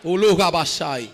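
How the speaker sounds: slow, low murmured tongues; speaking in tongues. Real, not acted. Preacher speaking in tongues mid-sermon. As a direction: let the tongues roll out low.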